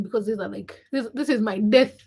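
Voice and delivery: normal talking voice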